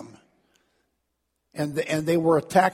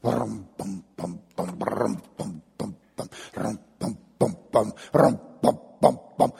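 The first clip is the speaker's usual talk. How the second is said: distant drum beat; 7 semitones lower than usual. Retold or imitated. Preacher mimicking distant parade drums, low and muffled. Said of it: "you'd hear that noise way off".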